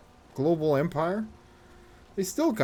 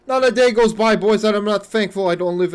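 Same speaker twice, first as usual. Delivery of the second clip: big boast; 6 semitones higher, 11 dB louder than usual. Real, not acted. Streamer boasting to viewers about living in Canada, loud and proud. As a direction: proclaim it like a victory speech.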